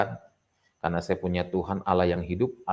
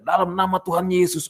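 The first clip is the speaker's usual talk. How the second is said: seruan doa tinggi bercampur geli; doa deg-degan; 10 semitones higher, 4 dB louder than usual. Retold or imitated. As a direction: serukan doa sambil menahan gugup. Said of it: "Ya udah, saya deg-degan". (doa lantang bernada tinggi)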